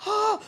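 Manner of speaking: high startled yelp, mocking his own panic; a short sharp cry as the sled comes at you; retold scream. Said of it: "I was like".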